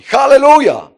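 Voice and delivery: loud, high hallelujah; joyful shout. Real, not acted. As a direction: cry out in praise. Preacher's joyful outburst.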